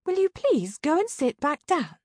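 high, pleading-to-bossy sidekick voice; high-pitched exasperated request; Sabrina. A narrator's reading. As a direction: pitch it up and nag your partner. Sabrina's character voice in narration.

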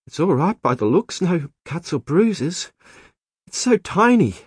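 high-pitched, emotional woman's voice. A narrator's reading. Woman examining the baby.

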